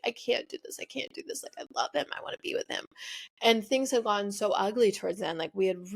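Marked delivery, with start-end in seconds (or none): 0.0-2.9 softer, hushed pleading; fading to a soft whisper; tearful whisper. Retold or imitated. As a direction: whisper it like a heartbroken girl to her mom. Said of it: "I'd be like".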